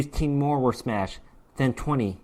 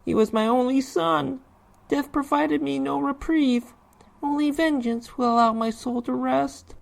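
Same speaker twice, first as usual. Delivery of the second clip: high, strained voice of a grieving ghost; a dead father demanding revenge, high and aching; vengeful ghost father; 13 semitones higher than usual. Acted. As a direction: plead for vengeance from beyond the grave, voice pitched up. Vengeful dead father's spoken lament.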